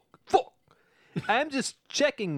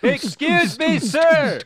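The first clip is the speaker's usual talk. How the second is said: louder and higher over music; 7 semitones higher, 6 dB louder, slower than usual. Acted. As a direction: call out over loud music. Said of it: "In a louder voice".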